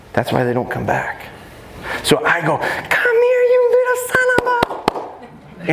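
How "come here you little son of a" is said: very high, drawn-out fake-sweet dog call; sweet-talk trick. Shown as a demonstration. Trainer tricking a dog into coming, fake-sweet high voice. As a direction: lure the dog with a squeaky sing-song. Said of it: "and I trick 'em into coming".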